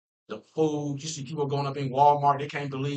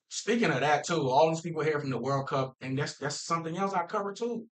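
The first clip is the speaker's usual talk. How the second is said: ordinary talk, nothing marked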